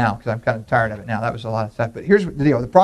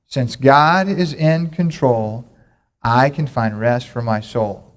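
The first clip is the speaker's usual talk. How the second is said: slow, deliberate call-and-response chant; slow recitation; 2 semitones lower, much slower than usual. Shown as a demonstration. Pastor leading the church in a memory verse, slow chant. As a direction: spell it out slowly for the congregation to repeat. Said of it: "we're gonna say it together".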